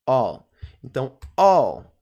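I'll as a loud "all"; low, emphatic "all" for I will; reduced contraction. Shown as a demonstration. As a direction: repeat the one-syllable "all" firmly for learners. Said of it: "All é a pronúncia".

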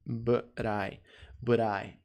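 soft "but I" said as "buh-rye"; "but I" joined into one word; linked pronunciation. Shown as a demonstration. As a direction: tap the t in "but I" like a quick r. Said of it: "Algo como isso aqui".

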